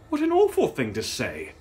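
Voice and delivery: loud, high indignant cry; shocked outrage. A narrator's reading. Sister shocked by the cruel insult, loud. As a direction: recoil in offended shock.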